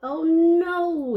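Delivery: ordinary delivery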